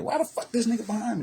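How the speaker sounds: nervous cop asking why a man is tailing him, in a high panicky voice; mock panicked cop. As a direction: play the spooked cop, voice leaping up high. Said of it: "He was like".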